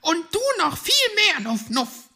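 very high, loud hedgehog scolding; stern squeaky hedgehog mother; strict. Acted. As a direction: put the youngster sternly in place. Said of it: "sagte Frau Igel streng".

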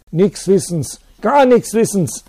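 high, loud shrill shriek, slow. Acted. Teacher shrieking back at the priest. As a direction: screech the denial back. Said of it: "kreischte der Lehrer".

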